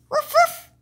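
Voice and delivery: high, loud little woofs; dog barking. Pretend. Toy puppy barks happily at friends in the park. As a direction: bark like an excited puppy.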